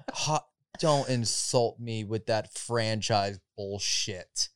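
slow, low, quiet mock offense; mock offended. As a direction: play at being insulted, slowly. Mock offended over toy brand.

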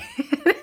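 quick giggle bursting out; a small embarrassed laugh; short high laugh. Real, not acted. Laughing at own story.

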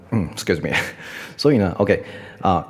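ordinary talk

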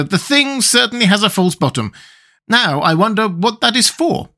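high, wondering storybook figure; puzzling Tin Woodman. Acted. Tin Woodman inspects the box for his friend, wondering.

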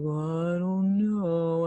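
slow, bummed-out mope; Eeyore. Acted. Showing what depression is like, slow and bummed-out Eeyore voice. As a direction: drag it out like a gloomy cartoon donkey. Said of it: "you look at Eeyore and he's just like".

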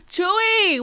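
gasp, then high excited call; real surprise, pitched well up; surprised greeting. Real, not acted. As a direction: call out the name in delighted surprise.

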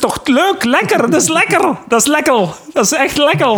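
squeaky cartoonish high voice; high put-on voice. Friends fooling around in a high silly voice. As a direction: pitch way up like a cartoon character.

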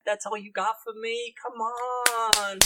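high-pitched dude-bro goading; mock-disbelieving bro character; disappointed bro boss. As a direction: prod the captive in a whiny bro voice, drawing out the end. Guildmaster goads silent captive.

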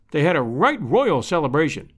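plain reading voice

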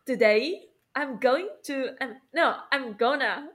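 slow drawn-out American going; today I'm going said drawn out with an American lilt; American accent. Retold or imitated. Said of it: "他们是".

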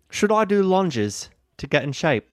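put-on deliberate Australian-accented wife's question. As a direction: ask it slowly in a mock Australian accent. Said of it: "That's funnier in your accent".

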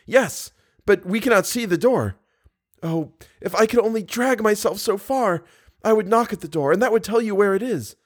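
put-on Christine, captive young woman. A narrator's reading. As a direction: explain urgently in a high female character voice.

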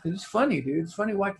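usual speaking voice

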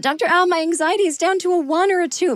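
high-pitched eager pupil reporting in; imitating classmates. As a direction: put on a high, keen classmate voice. Mimics relieved classmates reporting.

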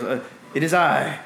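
high, drawn-out proclamation of his own name; grand wizard announcement. Acted. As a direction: announce yourself like a showy wizard.

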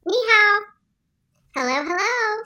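high, put-on guest voice; virus character voice. Co-host plays the virus guest.

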